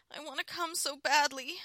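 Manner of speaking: high, very loud desperate plea; begging. A narrator's reading. Wife pleading with her husband, desperately begging. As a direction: wail it out, unable to wait. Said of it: "I begged him".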